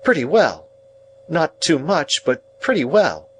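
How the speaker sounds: high-pitched, curt sister; tart, grudging reply. A narrator's reading. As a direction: give a short, measured verdict. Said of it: "returned my sister curtly".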